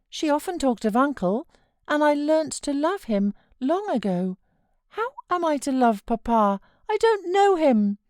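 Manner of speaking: put-on a fretful child pleading to stay; sickly child. A narrator's reading. Boy refusing to leave his uncle, high fretful whine. Child Linton keeps questioning.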